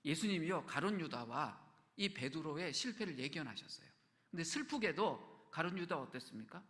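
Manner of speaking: normal talking voice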